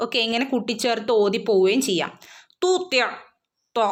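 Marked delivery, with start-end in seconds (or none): 2.6-3.0 തൂത്യ with a full, raised ta sound; high, full തൂത്യ; heavy ta. Shown as a demonstration. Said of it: "ഉയർത്തി പറയേണ്ട ലെറ്ററാണ് ത്വ". (ത്വ ഉയർത്തി തൂത്യ)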